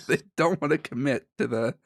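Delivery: usual speaking voice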